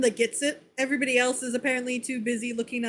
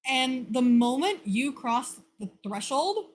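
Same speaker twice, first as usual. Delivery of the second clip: ordinary talk, nothing marked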